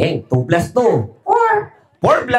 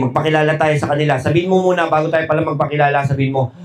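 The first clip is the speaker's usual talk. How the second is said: ordinary delivery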